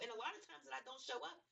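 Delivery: ordinary talk, nothing marked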